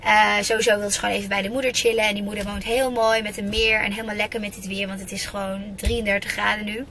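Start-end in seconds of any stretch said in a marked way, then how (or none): none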